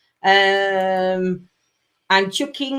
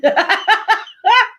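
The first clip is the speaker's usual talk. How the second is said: high burst of laughter; high, helpless laughing; 12 semitones higher, 4 dB louder than usual. Real, not acted. Laughing after apologising.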